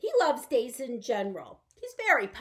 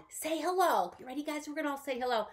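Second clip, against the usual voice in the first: ordinary talk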